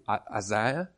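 low, tentative South African-style "Isaiah"; questioning attempt at a South African accent; imitated local accent. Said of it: "you say it so wonderfully".

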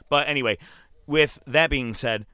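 usual speaking voice

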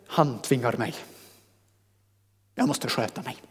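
soft, subdued resignation; mock downtrodden. Preacher voicing a believer under compulsion, soft and resigned. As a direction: sound meekly burdened, letting the voice drop.

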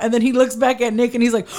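usual speaking voice

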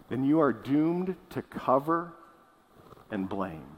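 deliberate, with a pause before the final words; slow and clear. Real, not acted. Preacher telling the church what follows, slow and clear. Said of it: "say this very slowly and clearly".

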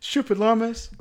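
high-pitched imitation of calling out; hammed-up exasperated shout; mock indignant yell. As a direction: mimic a cry thrown back while fleeing. Imitates characters yelling while running away.

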